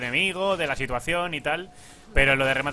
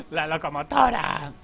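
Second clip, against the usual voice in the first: slow, higher "locomotora" stretched through laughter; laughing, drawn out; 8 semitones higher, slower than usual. Real, not acted. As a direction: savour "locomotora" slowly while laughing. ("locomotora" alargada y lenta entre risas)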